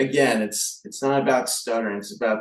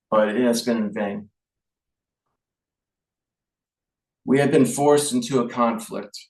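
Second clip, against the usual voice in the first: formal speech reading. Shown as a demonstration. Reading the King's wartime speech to the audience, solemn measured address. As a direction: read it like a wartime king to his nation. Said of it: "I want to conclude my speech with reading the King's Speech".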